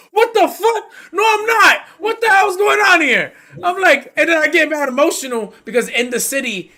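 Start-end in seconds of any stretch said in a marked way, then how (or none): 0.1-3.3 very high, loud, laughing outrage; high shocked protest at the judge; outraged disbelief. Retold or imitated.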